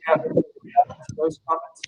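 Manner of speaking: muffled, as if a hand covers the mouth; dull, blocked sound through the mic. Real, not acted. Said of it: "you're coming in muffled".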